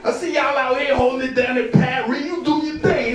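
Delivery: high, loud excited hype; hyped greeting. Retold or imitated. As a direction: greet him like a long-lost brother.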